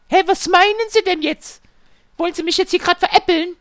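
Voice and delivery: high, loud offended customer voice. Retold or imitated. Customer accusing the technician, high offended voice. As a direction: play her as insulted.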